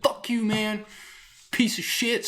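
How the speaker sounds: mock the junkie's tantrum in a high voice; cursing crackhead. Refused crackhead cursing out the worker, high mocking snarl.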